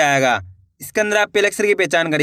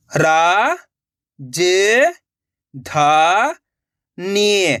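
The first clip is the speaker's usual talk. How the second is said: syllable by syllable; much slower than usual. Shown as a demonstration. Teacher sounding out a word for learners, syllable by syllable. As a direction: separate drawn-out syllables with long gaps. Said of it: "direct मात्रा का उच्चारण करके पढ़ेंगे".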